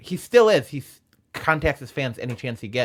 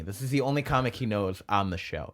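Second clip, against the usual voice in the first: ordinary delivery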